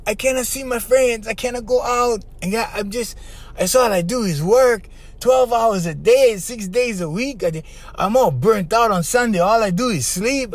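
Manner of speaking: a hint of a tearful young woman; upset client. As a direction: complain like she's worn out. Client voiced complaining, upset.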